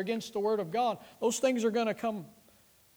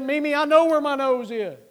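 put-on high, loud protesting kid voice; kid's loud, high-pitched retort; indignant child; 6 semitones higher, 9 dB louder than usual. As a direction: imitate a little boy insisting at full volume.

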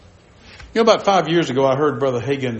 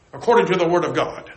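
ordinary talk

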